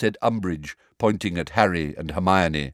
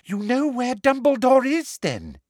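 high, eager prim witch; prim teacher pressing a pupil eagerly; Umbridge voice; 3 dB louder than usual. A narrator's reading.

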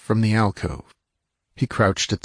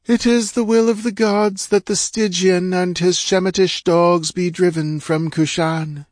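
high-pitched impersonal pronouncement; cold, detached oracle; 10 semitones higher, 3 dB louder than usual. A narrator's reading. False goddess giving the gods' verdict to priests, cold and impersonal. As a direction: intone it as a goddess handing down a decree. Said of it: "it seemed cold, detached, impersonal".

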